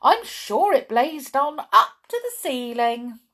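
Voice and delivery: high, loud gleeful answer; happily. Acted. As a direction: relish the bad news. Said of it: "said Missus Gabble happily".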